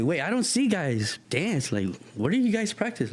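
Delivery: high-pitched imitated curiosity; puzzled surprise. Kathy quizzing street dancers on practice, surprised high voice. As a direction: question them in a lifted, disbelieving voice.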